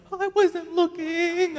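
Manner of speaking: high, drawn-out fake innocence; feigned nonchalance. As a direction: stretch it out, mimicking a guy caught looking. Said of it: "they'll be like this".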